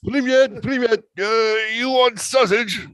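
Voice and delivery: very high, loud caricature voice; mock Belarusian woman. Playing a Belarusian woman offering sausage, in shrieky mock voice. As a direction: screech it out as a hammed-up caricature.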